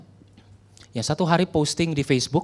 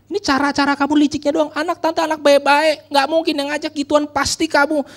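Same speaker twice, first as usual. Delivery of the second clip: high, loud scolding tirade; acted-out irate mother, high-pitched accusations; angry accusing; 10 semitones higher, 7 dB louder than usual. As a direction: tear into the girl as the furious mother. Mother angrily accuses the girl.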